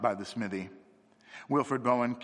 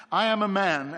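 gravely; 9 semitones higher, 8 dB louder than usual. A narrator's reading. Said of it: "answered Father Brown gravely".

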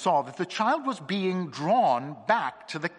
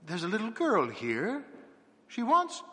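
lightly voiced spirit, higher pitch; a hint of a séance spirit, lifted in pitch; spirit messenger; 7 semitones higher than usual. A narrator's reading. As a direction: break off with the sentence hanging high. Spirit Sister Sylvia speaks at seance.

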